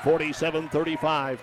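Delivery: ordinary delivery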